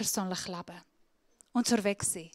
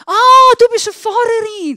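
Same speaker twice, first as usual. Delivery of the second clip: much higher, much louder, surprised discovery; excited hairdresser; 10 semitones higher, 13 dB louder than usual. Retold or imitated. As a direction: play the hairdresser who has just caught on. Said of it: "dann plötzlich gefragt". (überraschte Coiffeurin, hoch und laut)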